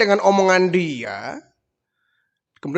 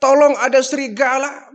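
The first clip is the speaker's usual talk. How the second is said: loud high desperate cry; panicked cry for help; 8 semitones higher, 4 dB louder than usual. Retold or imitated. Boy terrified as wolves eat his goats, panicked. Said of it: "dia teriak-teriak".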